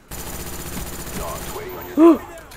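high breathless gasp of alarm; sharp gasp. Real, not acted. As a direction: gasp in sudden fright. Narrow escape in game.

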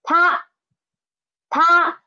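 她 said high and level, first tone, clear model for repeating. Shown as a demonstration. Teacher to class, 她 sung out high and level. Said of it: "read after me".

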